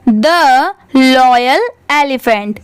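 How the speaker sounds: very slow, word-by-word title reading; careful read-aloud. Shown as a demonstration. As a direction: read it out slowly for beginners. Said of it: "पूरे paragraph को एक बार अच्छे से written read". (धीरे-धीरे साफ़ पढ़ना)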